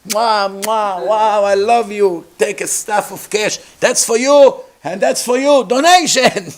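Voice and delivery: high, loud, overjoyed kisses and praise; shower them with cash and kisses; gushing flatterer. Pretend. Yoshua gushing as he hands Eldad and Medad cash, loud and high.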